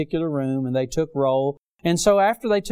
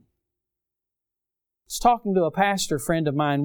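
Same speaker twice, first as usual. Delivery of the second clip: normal talking voice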